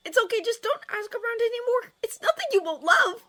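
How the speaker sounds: very high, loud character voice; Fishlegs pleading. A narrator's reading. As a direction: plead anxiously in a squeaky voice.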